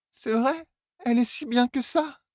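high, shaky hurt question; tearful drunk Elaine. Acted. Elaine asking Tom about her mother, high and tearful. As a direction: ask through tears.

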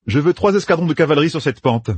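planning aloud with brisk confidence; a hint of a young general laying out plans; Matt's voice. A narrator's reading. Narrator voices Matt.

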